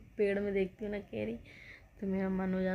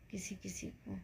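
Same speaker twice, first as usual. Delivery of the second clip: hushed prayerful wish; 10 dB quieter than usual. Real, not acted. Wishing illness on nobody.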